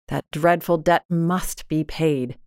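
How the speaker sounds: usual speaking voice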